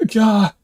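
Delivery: horrified gasp. Real, not acted. Remembers chocolate toxic to cats.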